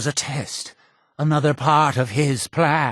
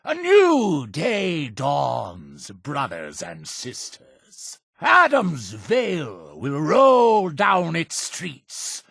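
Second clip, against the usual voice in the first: slow, soaring sermon; exalted preaching; 5 semitones higher, slower than usual. Acted. Cult leader promising followers a new day, exalted preaching. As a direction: proclaim the holy vision in long, high phrases.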